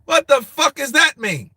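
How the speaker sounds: loud, high-pitched incredulous outburst; exasperated bafflement. Real, not acted. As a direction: cry out for an explanation. Still baffled by chat's British slang.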